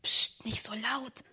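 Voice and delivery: slow hushed whisper; boy hushing his sister by the window. Acted. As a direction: shush her urgently.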